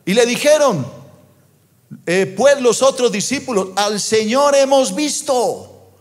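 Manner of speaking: high, ringing preacher proclamation; impassioned scripture reading. Acted. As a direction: announce it high, stretching the final phrase. Preaches scripture at full force.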